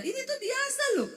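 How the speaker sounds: high, indignant insistence; acted touchy comeback, pitched high; cross-sounding protest. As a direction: insist it is normal, voice shooting up. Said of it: "nadamu tuh nesu loh".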